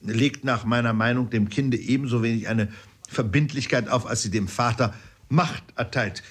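usual speaking voice

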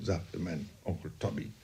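ordinary delivery

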